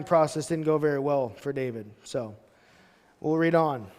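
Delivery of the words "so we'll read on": ordinary delivery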